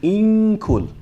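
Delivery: high "In kul" with a held nasal n; hidden noon drill. Shown as a demonstration. Teacher leading students to repeat, "In kul" with hidden noon. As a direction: hum the n of "In kul" into the k. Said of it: "let's do the first one".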